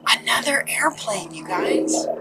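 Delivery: hushed whisper about the plane overhead; whispered aside. Real, not acted. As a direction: drop to a whisper, just for the listeners. Aside about passing airplane noise.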